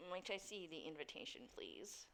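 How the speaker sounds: soft, low, polite doorman; formal doorman. Acted. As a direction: ask with a servant's courtesy. Game master plays doorman.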